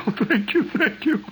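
high, giggling thank-you through laughter; laughing grateful plea. Retold or imitated. Patient thanks dentist, laughing high and breathless. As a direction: beg with relief while cracking up. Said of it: "cling to his knees and say".

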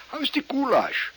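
put-on high German-accented question; mock German accent. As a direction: ask it in fake German. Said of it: "everything I say is in a German accent".

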